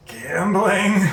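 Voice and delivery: high, guilty little answer; sheepish excuse. Acted. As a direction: offer it up weakly, pitched high. Caught out by Pao, sheepish answer.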